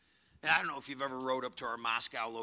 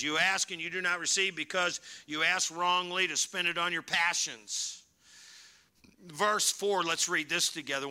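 loud, raised congregational reading; scripture reading aloud; 4 semitones higher, 7 dB louder than usual. Real, not acted. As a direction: read the verse out forcefully.

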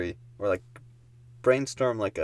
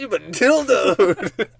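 announcer voice; 10 semitones higher, 10 dB louder, slower than usual. Pretend. Silly announcer catchphrase.

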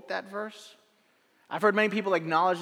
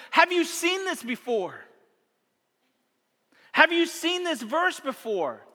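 higher, slow, eager asking; bursting to share a discovery; excited question; 8 semitones higher, slower than usual. Retold or imitated. Excited teen asking friends.

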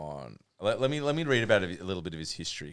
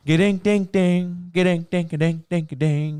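louder, higher sung-out guitar twangs; vocal guitar licks; 5 semitones higher, 8 dB louder than usual. Retold or imitated. Showing friends [name]'s playing, voicing the guitar. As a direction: play the guitar lick with your mouth.

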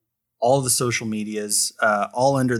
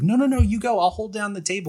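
higher-pitched, insistent wave-off; urging someone to go, in a raised voice; insistent wife; 10 semitones higher, 3 dB louder, faster than usual. Retold or imitated. Wife's insistence, retold.

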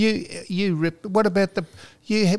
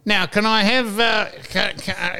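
higher, halting nasal clerk voice; congested caller imitation; 6 semitones higher than usual. Phone clerk haltingly asks a caller for details, mock congested. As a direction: mimic a stuffed-up phone operator. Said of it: "He's got some nasal congestion".

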